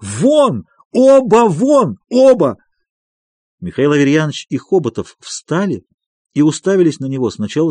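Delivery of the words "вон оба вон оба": high, loud, long-drawn shout; furious shouting. A narrator's reading. Doctor throwing both visitors out, furious shouting. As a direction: bellow the words in a rage. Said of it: "продолжал кричать Андрей Ефимович".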